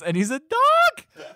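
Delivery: high, loud, drawn-out exclamation; excited reveal. Real, not acted. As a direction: blurt the absurd twist, stretching it out high. Teller reveals absurd movie twist.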